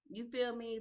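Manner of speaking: usual speaking voice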